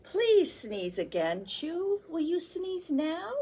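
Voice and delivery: high pleading question; coaxing. Acted. Grown-ups begging Chew to sneeze, high and hopeful. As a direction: coax him hopefully.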